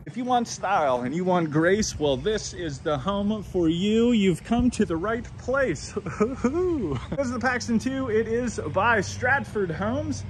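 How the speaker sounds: drawn-out, lilting showman's intro; sing-song pitch. Pretend. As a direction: sell it like a playful jingle. Playful jingle-like intro of the home.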